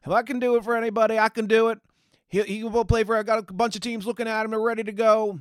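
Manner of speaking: high, hammed-up Southern agent voice; mocking Southern accent. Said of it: "I know he doesn't have a Southern accent, but I'm doing it".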